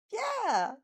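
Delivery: excited yell. A narrator's reading. Mina cheering with the others, high excited yell. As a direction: yelp it with delight. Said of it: "Mina yells".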